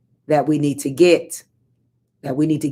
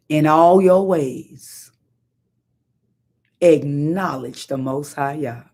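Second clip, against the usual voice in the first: slow solemn; 3 semitones lower, slower than usual. Shown as a demonstration. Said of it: "slow scripture quote".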